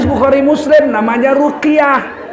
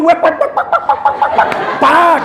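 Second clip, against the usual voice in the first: high mocking wek wek nag; mock nagging chatter; 6 semitones higher than usual. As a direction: chatter like a scolding wife. Wife nagging imitated. (ocehan istri yang mengomel)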